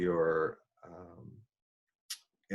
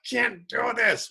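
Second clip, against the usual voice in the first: mock wail; 13 semitones higher, 4 dB louder than usual. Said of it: "I was screaming".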